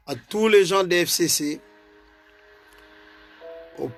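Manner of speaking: usual speaking voice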